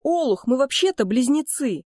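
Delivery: high, exasperated outburst; outraged scolding. A narrator's reading. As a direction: scold a dim-witted brother. Outraged twin insults his brother. (возмущённо отчитывая брата)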